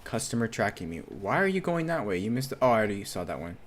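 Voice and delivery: ordinary delivery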